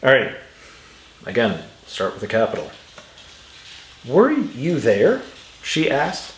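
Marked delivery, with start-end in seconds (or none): 4.0-5.2 "Were you there?" as a question, pitch swinging up on you; questioning intonation. Shown as a demonstration. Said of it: "listen to those points of intonation".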